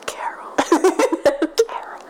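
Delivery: raspy, breathy whisper of a feared name; hoarse whisper. Acted. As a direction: whisper it hoarsely, as if afraid to summon her. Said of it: "he says the name in a hoarse whisper".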